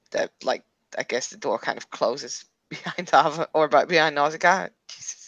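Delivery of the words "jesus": ordinary talk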